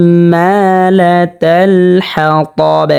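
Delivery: slow হাম্মালাতাল with a long hummed m; recited হাম্মালাতাল হাতাব, nasal m held; held ghunna. Shown as a demonstration. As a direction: hum the ম্ম of হাম্মালাতাল, then stretch মা one beat. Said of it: "এখানে গুণনা করা লাগবে".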